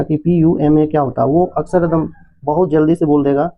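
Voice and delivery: normal talking voice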